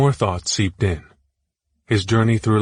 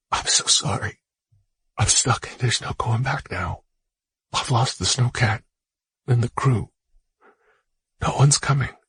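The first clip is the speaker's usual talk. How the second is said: choking back tears; 3 semitones higher than usual. Acted. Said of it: "choking back tears".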